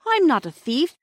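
put-on high, loud protest from a caught boy; firm denial. A narrator's reading. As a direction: insist firmly on innocence. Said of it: "said Peter as firmly as he could".

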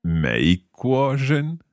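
hesitant learner attempt; slow careful. Shown as a demonstration. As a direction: ease into 美国人 deliberately. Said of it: "我是".

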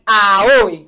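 loud, sharp indignant exclamation; mock outrage. Real, not acted. Reacting to a nosy question about her husband, loud mock outrage. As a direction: act scandalised by the question.

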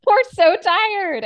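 laughing. Real, not acted. Laughing while saying it.

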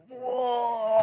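high, outraged cry; angry grannies' outcry. Retold or imitated. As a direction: cry out in protest. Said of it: "They go".

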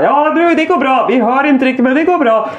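high-pitched calling across distance; acted upbeat shouting; called-out reassurance. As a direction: reassure someone as if hollering down a slope.

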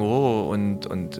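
ordinary delivery

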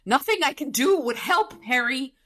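high, loud distressed retort; acted high-pitched upset; upset Butters. A narrator's reading. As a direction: protest in a high, helpless voice. Voicing upset Butters arguing back.

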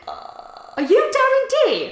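high, loud, drawn-out shriek of a question; in-his-face blurting. Retold or imitated. Shop worker to a celebrity customer, blurted loudly in his face. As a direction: squeal it right at them, stretching it out. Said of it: "Right in his face".

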